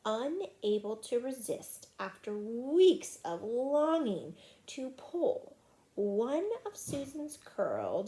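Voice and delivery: ordinary delivery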